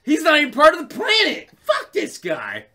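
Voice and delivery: laughing scornful rant; kid's disgust. Retold or imitated. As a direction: sneer at it like a fed-up kid. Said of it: "I'm like".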